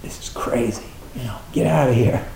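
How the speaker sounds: whispered, then a quick dismissive mutter; a hint of a nervous inner voice; little mind's voice. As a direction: voice the scared little mind brushing it off. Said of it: "the little mind is saying".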